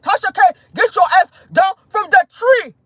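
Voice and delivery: high, loud put-on African accent. Investigator ordering Tasha K down, mock African accent. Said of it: "Y'all like my, uh, African accent?".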